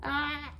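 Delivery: yawn sound effect. Retold or imitated. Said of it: "I always make a sound effect when Hobbs yawns".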